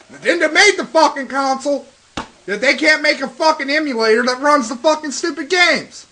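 mad. Real, not acted. Gamer still grumbling at Nintendo after his outburst, mad. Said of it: "I'm just mad. Seriously".

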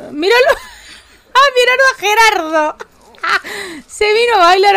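real delighted laughter; laughing. Real, not acted. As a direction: burst out laughing at Gerardo dancing. Laughing at dancing Sim.

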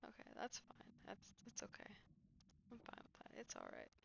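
whispering. Real, not acted. Late night, resigned to self.

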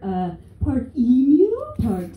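ordinary talk, nothing marked

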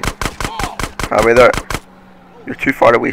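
usual speaking voice

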